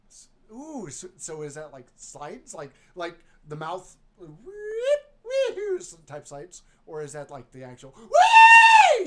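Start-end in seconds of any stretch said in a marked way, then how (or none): 4.2-6.6 high, slow wee-hoo; slide whoop. Pretend. 8.0-9.1 very high, loud, slow whee; yelling whee. Pretend. Mimicking slide whoop.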